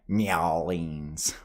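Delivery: "Nyowlings" drawn out as NYAH-leens; mock game-character pronunciation of "Nyowlings"; Gabriel Knight. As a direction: say "Nyowlings" with a stretched first syllable. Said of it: "say it like Gabriel Knight".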